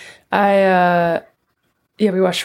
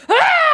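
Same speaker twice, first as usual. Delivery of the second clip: high loud startled shriek; 7 dB louder than usual. Retold or imitated. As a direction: yelp as if touched by ice. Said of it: "She was like".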